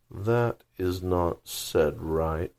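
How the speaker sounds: very slow, drawn-out creature voice; caterpillar voice. Acted. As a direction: drawl it like a lazy, stern caterpillar. Caterpillar judges.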